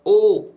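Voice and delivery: isolated "ओ", pushed out to show the vowel. Shown as a demonstration. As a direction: lift and hold the long o of "ओ". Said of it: "ओ का sound क्या करता है".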